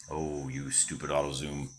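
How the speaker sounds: quiet irritated aside to the camera; annoyed aside. Real, not acted. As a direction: grumble at the auto zoom under your breath.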